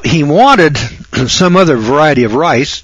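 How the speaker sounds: ordinary delivery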